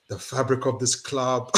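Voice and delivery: slow, low grave imitation; slow, low, hammed-up gravity; mock solemn manager. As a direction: intone it like a solemn sermon. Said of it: "Postecoglou's like".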